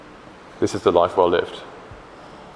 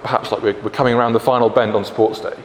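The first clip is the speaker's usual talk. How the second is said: ordinary talk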